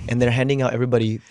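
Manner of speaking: ordinary talk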